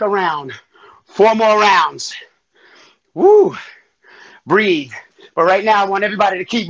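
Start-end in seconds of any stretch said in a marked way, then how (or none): 3.1-3.6 high whooped "woo"; workout whoop. Real, not acted. Instructor to class after a round, workout whoop. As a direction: whoop out the effort.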